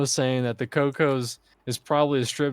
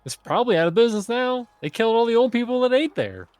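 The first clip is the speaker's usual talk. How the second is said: higher, louder joking claim; exaggerated joke; 10 semitones higher, 4 dB louder, faster than usual. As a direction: pitch it up and play it big.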